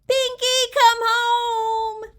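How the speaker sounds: high, loud sing-song call. A narrator's reading. Child calling the lost mouse, high sing-song shout. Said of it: "I shouted".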